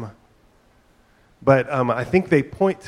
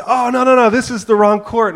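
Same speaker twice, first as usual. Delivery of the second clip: much higher and louder burst; excited realization; 11 semitones higher, 8 dB louder than usual. Retold or imitated. Son realizing they are on the wrong court, excited shout. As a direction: blurt it out in sudden discovery.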